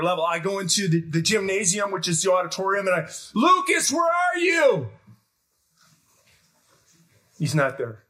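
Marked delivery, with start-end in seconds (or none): 3.3-4.9 louder, higher frantic shout; calling out. Retold or imitated. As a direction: yell it across the gym. Searching gym for lost son.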